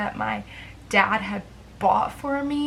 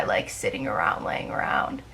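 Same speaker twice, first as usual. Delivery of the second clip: sheepish whispered admission; whispered confession; 13 semitones lower, 3 dB quieter than usual. Real, not acted. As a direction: drop to a whisper, a little ashamed. Admitting depression habits.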